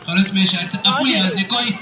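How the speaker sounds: very low-pitched puppet character; Aragoz puppet. Acted. Puppet explaining his visit to the host, Aragoz voice. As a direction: play a comic puppet, far below your own pitch. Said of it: "الأراجوز ده وهو بيرغي".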